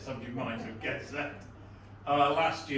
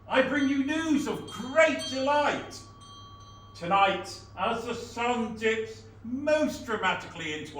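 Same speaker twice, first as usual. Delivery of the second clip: town crier; 8 semitones higher, 8 dB louder than usual. Acted. Town crier audition proclamation.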